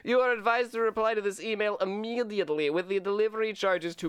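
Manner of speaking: hammed-up Russian video game villain; cheesy Russian bad-guy accent. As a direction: read it like an eighties-movie Russian bad guy. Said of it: "bad Russian".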